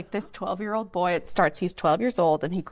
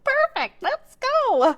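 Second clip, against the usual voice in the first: very high, loud hurrah; excited cheer; 6 dB louder, much slower than usual. Retold or imitated. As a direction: cheer it out, pitched way up. Acts out joining the club.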